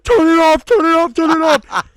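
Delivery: very high, loud, frantic repeated plea; imitated panicked screaming. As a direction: shriek it like a friend panicking at a horror film. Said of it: "Anna was screaming and crying".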